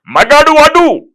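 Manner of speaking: slow, high shouted villain line; a slow shout of మగాడు వాడు; villain roar. Retold or imitated. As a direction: bellow it in contempt. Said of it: "Sampath గారు అయితే ఎట్లా మాట్లాడతారంటే".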